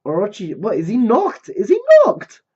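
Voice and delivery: rising, loud shocked questioning; stunned and yelling; incredulous. Real, not acted. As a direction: ask in mounting disbelief, ending in a high shout. Disbelief at Orochi knocked out.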